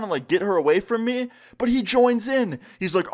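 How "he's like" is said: ordinary delivery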